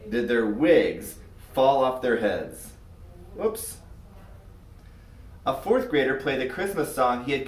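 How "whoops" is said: playful whoops. A narrator's reading. Narrator's playful aside.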